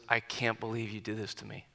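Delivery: low, soft imitation of a hurt friend; retold tearful hurt. Buddy in the locker room confronting him, retold hurt. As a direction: quote his hurt quietly, without crying. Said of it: "he had tears flowing down his face".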